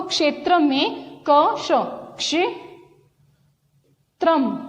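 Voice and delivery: क्षेत्रम stretched very slowly, each part apart; drawn-out syllables. Shown as a demonstration. Teacher stretching क्षेत्रम so children hear ksh, t, r. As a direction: break क्षेत्रम into slow parts. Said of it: "उच्चारण कीजिए क्षेत्रम".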